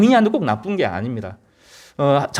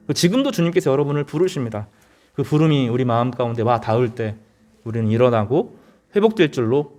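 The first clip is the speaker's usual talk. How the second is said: ordinary talk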